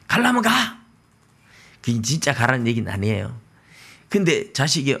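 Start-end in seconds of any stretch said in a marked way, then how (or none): 0.1-0.5 higher mock shout of an angry mother; angry mom. Mother losing patience with arguing child, mock angry shout. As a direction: snap it out like a fed-up mom. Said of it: "엄마가 막 화가 나가지고".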